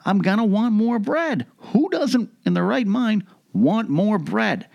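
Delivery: mock indignation. Real, not acted. Mock outrage over wanting bread.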